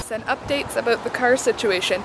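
usual speaking voice

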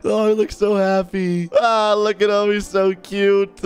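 ordinary talk, nothing marked

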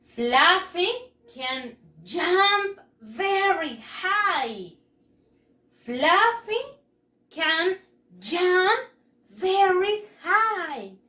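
very slow, each word stretched; slow model reading. Shown as a demonstration. Teacher reading slowly for pupils to repeat. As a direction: drag the words out so children can copy. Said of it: "repitan después de mí".

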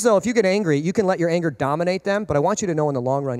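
ordinary talk

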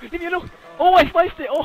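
high-pitched alarm at a game monster; panicked yelp. Real, not acted. Player who startled the witch, panicked yelp.

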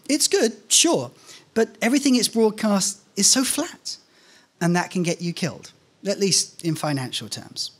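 plain reading voice

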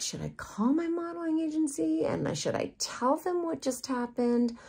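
high, worried inner voice; anxious self-questioning. Retold or imitated. Model wondering whether to tell her agency, anxious self-questioning.

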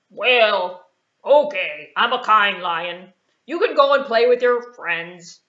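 loud, generous puppet lion; a big lion letting her off; kindly lion. Acted. Lion sparing the mouse, loud and grandly kind.

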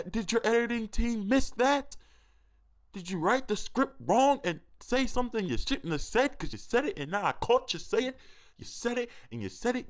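squeaky indignant exaggerated voice; mock outraged high voice. Vlogger in a silly put-on voice, hamming up outrage at Scarce. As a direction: ham up fake outrage at the diss.

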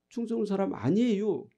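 mock Chungcheong-accented denial, falling at the end; Chungcheong dialect denial. Preacher acting out a Chungcheong native denying their origin, in dialect. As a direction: softly insist in a Chungcheong accent. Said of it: "당신 충청도 사람 맞지요?".

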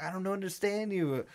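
high-pitched mock confusion; lightly hammed-up bewildered protest; dramatic. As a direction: imitate a friend being dramatic, without shouting. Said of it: "acting dramatic".

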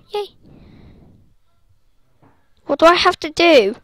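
ordinary delivery

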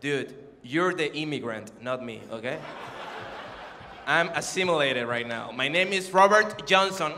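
low, slow put-on American English voice; mock-serious gringo-sounding officer. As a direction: deny your roots in deep, measured English. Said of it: "me ve con esta cara de".

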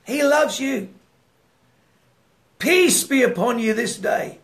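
fervent preacher ministering, rising then hushing; preaching prayer. Real, not acted. Preacher praying over his online viewers, fervent then hushed.

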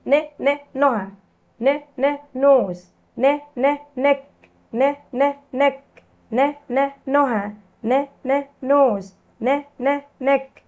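"نه، نه" in even short beats, then the full word; phonics drill. Shown as a demonstration. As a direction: drill the N sound twice before each word. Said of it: "حرف ال N بيتنطق". (تدريب صوتي: "نه، نه" منفصلة ثم الكلمة)

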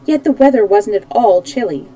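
ordinary delivery, nothing marked

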